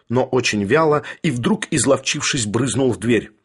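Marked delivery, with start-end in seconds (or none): none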